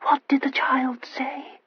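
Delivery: slow, feeble whisper; swooning mother whispering from the sofa, slowly; faint whisper. A narrator's reading. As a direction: barely breathe it out. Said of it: "just the strength to utter".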